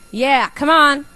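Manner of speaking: high, loud coaxing call; loud urging. Real, not acted. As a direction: egg the crowd on. Urging audience to raise hands.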